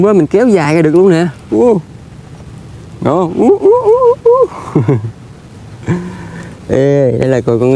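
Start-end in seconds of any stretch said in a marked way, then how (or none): 3.4-4.3 playful high squeal. Pretend. Playing with squeaky toy.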